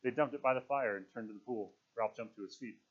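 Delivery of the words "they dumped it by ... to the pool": ordinary delivery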